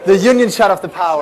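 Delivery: high, loud projected shout; calling out. Real, not acted. Speaker to audience after the power cut, calling out. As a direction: shout it to the whole room without the mic.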